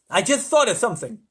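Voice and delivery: lightly voiced eager President; President's sudden idea. A narrator's reading. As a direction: announce it with self-importance.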